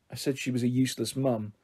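a touch of a quiver as she admits it; wobbly voice. A narrator's reading. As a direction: daughter owning up to Mike, voice starting to wobble. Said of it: "my voice going all wobbly".

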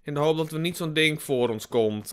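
ordinary talk, nothing marked

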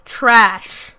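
normal talking voice

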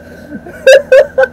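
high, loud cackling laugh; helpless laugh. Real, not acted. Vlogger losing it at his own cat song, high laugh. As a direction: burst out laughing. Cracks up after chant.

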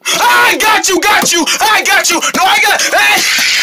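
high, yelled gotcha ending in a scream; frantic shouting. Acted. Catching the pigeon that copied him, frantic high shouting. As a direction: pounce with a high-pitched shout.

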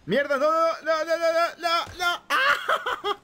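very high, loud panicked no's; panicked shriek. Real, not acted. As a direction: shriek in panic, ending in a laugh. Spotted and shot down.

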